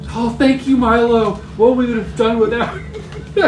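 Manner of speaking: high, loud stage thanks; gushing gratitude. Acted. Locke thanking Milo for the rescue, gushing theatrical voice. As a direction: overact relief at being rescued.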